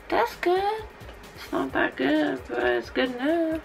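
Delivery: softly, under the breath; quiet. Real, not acted. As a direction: murmur it to yourself. Soft self-assessment, quieter.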